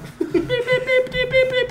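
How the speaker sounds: whirring printer noise made by mouth; printer impression. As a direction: hum like an old fax printer. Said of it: "Is that your best printer".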